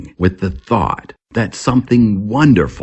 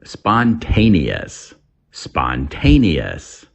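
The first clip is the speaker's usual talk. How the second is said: slow model word; slower than usual. Shown as a demonstration. Teacher models a new word for learners, slow and clear. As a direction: stretch out each syllable of Spontaneous slowly. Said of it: "English vocabulary".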